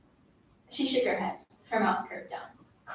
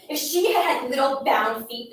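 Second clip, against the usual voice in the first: ordinary delivery, nothing marked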